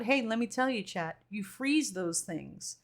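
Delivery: ordinary talk, nothing marked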